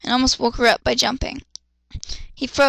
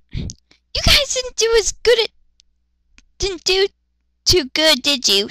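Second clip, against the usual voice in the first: put-on girly teasing voice; 7 semitones higher than usual. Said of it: "Luna voice".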